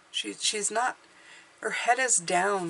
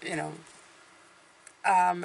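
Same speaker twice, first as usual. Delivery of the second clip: usual speaking voice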